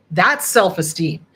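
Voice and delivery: ordinary talk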